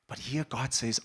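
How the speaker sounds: usual speaking voice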